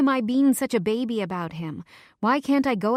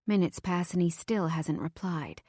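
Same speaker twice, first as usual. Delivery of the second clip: usual speaking voice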